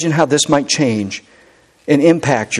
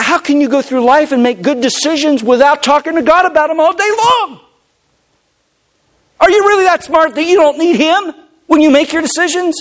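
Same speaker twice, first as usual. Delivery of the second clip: high, urgent preaching building to a shout; fervent preacher in full flight; impassioned preaching; 9 semitones higher than usual. Real, not acted. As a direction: press the congregation harder and harder, pitch climbing.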